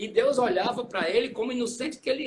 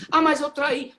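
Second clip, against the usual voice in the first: anxious confession; 6 semitones higher, 6 dB louder than usual. Pretend. Sinner confessing to Jesus.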